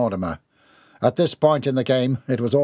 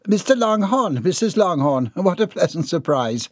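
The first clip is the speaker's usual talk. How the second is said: higher-pitched greeting through a forced smile; forced pleasantness; 7 semitones higher than usual. A narrator's reading. Housekeeper greeting unwelcome visitors, forced pleasantness. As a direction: put on a polite welcome. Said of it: "she forced a smile".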